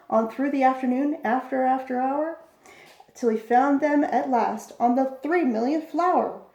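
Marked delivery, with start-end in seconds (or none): none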